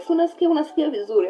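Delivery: light high quick nurse voice; a hint of a brisk nurse; nurse's quick question. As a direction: rattle it off like a busy nurse. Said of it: "So the nurse is like".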